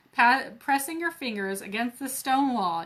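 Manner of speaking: ordinary delivery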